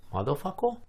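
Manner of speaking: put-on high little-kid voice, questioning; imitated child echo. Daughter echoing from the back seat, high questioning child voice. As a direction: innocently parrot it with childish sounds, rising.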